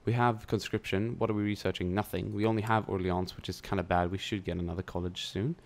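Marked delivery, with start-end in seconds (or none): none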